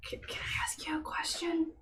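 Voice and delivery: whispered sleepover chat; whispering. Acted. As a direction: keep it to a whisper. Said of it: "Sleepover voices".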